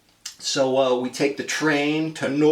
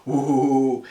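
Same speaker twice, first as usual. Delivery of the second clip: mocking coo. Commander mocking the piano player, teasing coo. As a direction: tease the pianist with a sing-song ooh. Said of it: "he mocks me".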